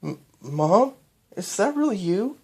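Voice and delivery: high halting voice, stunned; stunned disbelief. Acted. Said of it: "asked Cadance".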